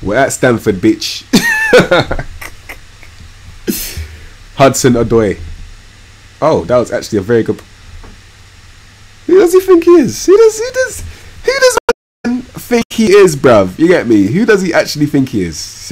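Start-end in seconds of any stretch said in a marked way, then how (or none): 1.3-2.7 high squealing laugh; sudden shrill giggle. Real, not acted. Laughing at his own joke. 9.3-13.4 high squeaky mock indignation, much higher; disbelieving high-pitched taunt; mocking outrage. Real, not acted. Mocking an opponent's showboating.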